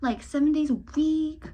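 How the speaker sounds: fast, high, breezy boast; mock overeager resolution; overconfident goal-setter. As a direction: brag it like you will surely manage it. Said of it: "be like".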